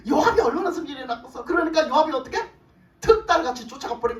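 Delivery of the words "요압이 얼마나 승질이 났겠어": laughing. Real, not acted.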